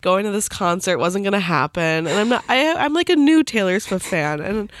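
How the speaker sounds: ordinary talk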